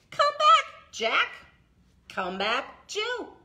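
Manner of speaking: first come back jumps high and loud; playful rhyme call. Shown as a demonstration. Librarian calls the blackbirds back for the toddlers, high and playful. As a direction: call the birds home brightly. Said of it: "Can you do it with me?".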